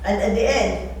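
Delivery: ordinary delivery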